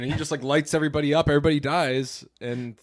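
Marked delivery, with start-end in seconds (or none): none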